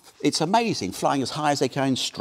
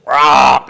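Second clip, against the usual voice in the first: loud imitated crow caw; crow squawk; 14 dB louder than usual. Female crow keeps at her mate, loud squawk. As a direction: keep squawking like an insistent crow. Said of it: "she keeps it up".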